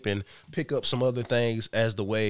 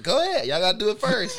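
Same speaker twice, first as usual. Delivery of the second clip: excited shout; 6 dB louder than usual. Real, not acted. Dinosaur roar game, shouting.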